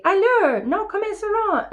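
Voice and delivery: high, mocking French echo; squeaky copycat mockery; mimicking the tutor. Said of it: "And I say".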